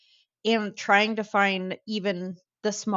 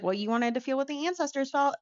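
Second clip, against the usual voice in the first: lightly imitated teasing mother, higher and quick; joking mom; 6 semitones higher, faster than usual. Mom joking to her child about ancestry, teasing. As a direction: tease with a quick, raised retort. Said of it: "my mom was joking".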